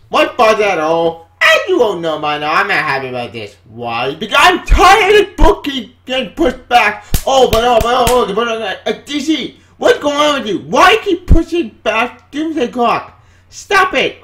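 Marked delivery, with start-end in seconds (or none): 4.1-14.2 higher, louder fed-up rant; fed-up fan yelling at DC; exasperated rant. Real, not acted. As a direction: vent your frustration at the publisher. Rant at DC delays.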